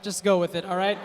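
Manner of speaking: ordinary talk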